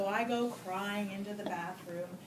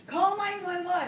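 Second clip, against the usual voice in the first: higher, louder urgent call; retold yell; 9 semitones higher, 9 dB louder than usual. Neighbor yelling to call for help, in a higher, louder call. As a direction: shout it like an alarmed neighbor. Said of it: "So my neighbor yells".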